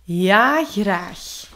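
loud, relieved yes, then easing. A narrator's reading. Finn accepting the dragon's request, relieved. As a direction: burst out yes, then settle. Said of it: "zei hij opgelukt".

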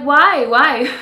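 loud, high, thrilled cries; excited disbelief. Retold or imitated. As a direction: squeal in happy disbelief. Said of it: "I was like".

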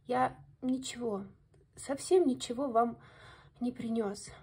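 ordinary delivery

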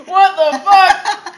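slow, loud laughing what the fuck. Real, not acted. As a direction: crack up on the words. Last take laughing.

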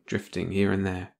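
usual speaking voice

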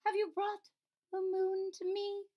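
put-on high, faint little girl voice; weak sick princess. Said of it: "her voice very weak".

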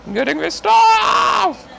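slow, very high scream of frustration; furious shout. Real, not acted. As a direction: explode into a drawn-out high yell. Explodes at the game, repeating.

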